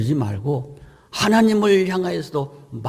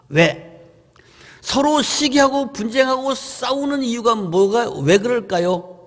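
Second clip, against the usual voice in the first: normal talking voice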